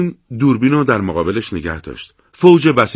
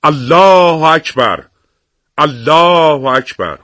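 very slow, loud, higher chanted cry; loud takbir; 5 semitones higher, 4 dB louder, much slower than usual. Acted. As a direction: ring out the takbir for the valley. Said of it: "با صدای بلند تکبیر سر داد". (تکبیر بلند و کشیده)

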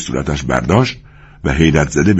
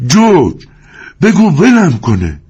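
high, loud, slow plea for help; frightened loud cry for help; 12 semitones higher, 5 dB louder, slower than usual. Acted. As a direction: yell for George in panic. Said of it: "لنی فریاد زد".

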